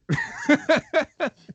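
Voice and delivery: high bright laugh; relieved laugh at the end. Real, not acted. Laughs after finishing.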